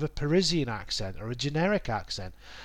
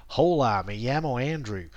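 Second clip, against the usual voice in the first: put-on Spanish with a North American English accent. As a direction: carry North American vowels straight into the Spanish. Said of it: "In, in North America".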